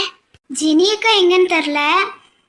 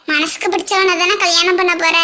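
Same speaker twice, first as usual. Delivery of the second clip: ordinary talk, nothing marked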